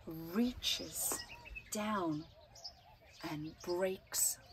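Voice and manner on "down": ordinary delivery, nothing marked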